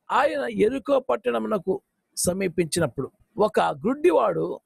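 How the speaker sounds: ordinary delivery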